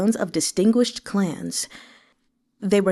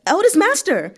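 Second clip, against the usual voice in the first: much higher, loud alarmed cry; cries; 11 semitones higher, 5 dB louder than usual. A narrator's reading. Servants crying out as their master faints, a high alarmed shout. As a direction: call out in alarm. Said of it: "Cries of".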